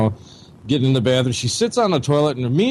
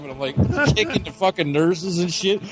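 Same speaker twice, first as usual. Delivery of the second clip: usual speaking voice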